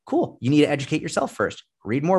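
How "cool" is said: a mocking, high-pitched "Cool"; sarcastic cool. Coach mocking a clueless coach's excuse, sarcastic. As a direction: toss it off with sarcastic disbelief.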